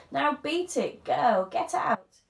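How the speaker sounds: loud, slow shopkeeper's yell; screamed. A narrator's reading. Mrs Pratchett screaming at the boys, loud and slow. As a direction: throw them out, loud and slow. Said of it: "she screamed".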